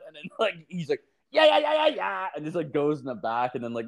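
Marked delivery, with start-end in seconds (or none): 1.3-2.3 very high-pitched burst of yeahs; imitated tic yelling. Retelling the ER patient answering the nurse, imitated yelling. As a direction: mimic a sudden vocal tic, shooting up high. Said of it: "walking around yelling".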